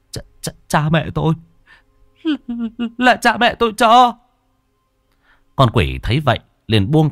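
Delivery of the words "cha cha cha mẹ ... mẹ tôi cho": put-on stammering, high-pitched sobbing boy; tearful whimpering. A narrator's reading. Frightened boy answers the demon about the bracelet, tearful stammer. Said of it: "Thằng bé Nhân lúc này mếu máo".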